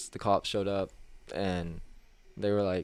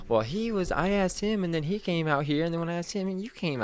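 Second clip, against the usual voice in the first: mimicked whiny customer; 9 semitones higher than usual. Customer protesting in retold quote.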